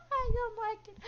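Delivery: high-pitched, amused squeal; laughing squeal. Real, not acted. Player reacts to a character's line, laughing squeal.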